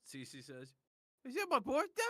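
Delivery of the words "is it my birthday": very high, squeaky excited voice; excited childlike character. Acted. C C asks about the gift, high delighted squeal. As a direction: squeal it like a delighted little one.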